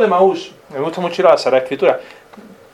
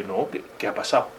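whispered puzzlement; 6 semitones lower, 11 dB quieter than usual. Acted. Disciples answer, puzzled.

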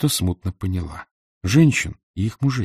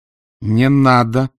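slow plea, a little raised; pleading cry; 3 semitones higher, 4 dB louder, much slower than usual. Acted. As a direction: beg him to stop. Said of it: "Луиза Грей сказала это".

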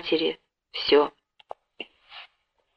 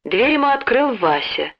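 plain reading voice

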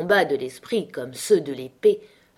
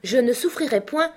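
outcry; 8 semitones higher, 4 dB louder than usual. A narrator's reading. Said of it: "s'écria monsieur Minxit".

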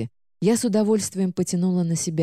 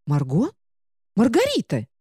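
high book voice calling out; indignant book calling; 5 semitones higher than usual. Acted. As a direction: call her name, offended. Book calls after heroine.

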